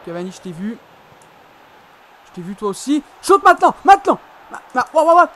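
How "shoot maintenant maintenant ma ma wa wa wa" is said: loud high urging to shoot; urgent shout. Real, not acted. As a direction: yell at the screen to shoot.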